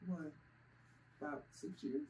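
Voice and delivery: usual speaking voice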